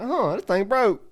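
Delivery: high-pitched, curious surprise at an injury; puzzled realization. Retold or imitated. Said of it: "like a question mark".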